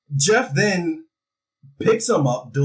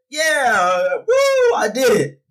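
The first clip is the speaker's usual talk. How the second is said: a hammed-up triumphant cheer, voice cracking up high; excited victory yell; 10 semitones higher, 4 dB louder, slower than usual. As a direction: whoop up high and loud, crying out I did it. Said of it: "And he's like".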